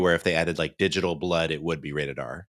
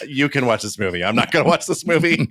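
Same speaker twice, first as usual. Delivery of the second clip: mock refusal; 6 semitones higher, 4 dB louder than usual. Wife refuses to watch film.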